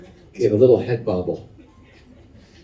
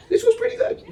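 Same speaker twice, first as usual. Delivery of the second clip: much higher, squeaky approval with a laugh; light up at the good berry; delighted taster; faster than usual. Retold or imitated. Old woman tasting and sorting fruit.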